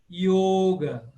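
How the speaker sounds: yoga with a closed O; closed 'o' as in telefone in yoga. Shown as a demonstration. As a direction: say 'YOH-ga' for yoga. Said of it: "sempre fechado como em telefone".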